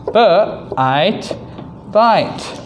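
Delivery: "b-, eit, bite" split into onset and rime, then blended; sounding out. Shown as a demonstration. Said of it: "number five".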